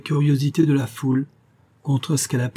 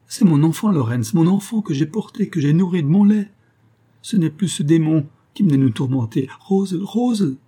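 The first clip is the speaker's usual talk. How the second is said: higher and louder, rising to a cry; anguished plea; 6 semitones higher, 4 dB louder than usual. A narrator's reading. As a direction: beg with a mother's anguish. Mother begs for her dying child. (supplication déchirante)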